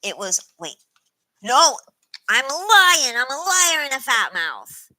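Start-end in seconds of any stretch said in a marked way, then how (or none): 1.4-4.9 loud, high, drawn-out retraction; emphatic self-correction. Real, not acted. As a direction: exclaim it, catching your own mistake. Abruptly retracting own wrong answer announcement.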